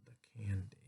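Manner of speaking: very soft, secretive whisper; hushed temptation. A narrator's reading. Storyteller reveals what Tia must pass, awed whisper. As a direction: breathe it like something forbidden.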